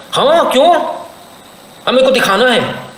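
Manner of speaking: high-pitched indignant comeback; mock-outraged girl refusing; defiant retort. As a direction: fire back indignantly, voice jumping up. Girl snapping back at veil request.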